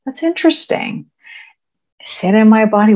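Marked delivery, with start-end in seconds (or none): 0.0-1.1 curious. Shown as a demonstration. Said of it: "I get curious".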